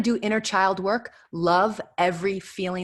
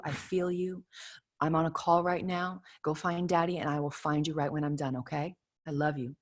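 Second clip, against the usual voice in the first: softer, gentle soothing; tender mother; 7 dB quieter than usual. Retold or imitated. Mother gently calming her four-year-old during a call, soft. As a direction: soothe a crying child quietly. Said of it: "If I look at my four-year-old and I say".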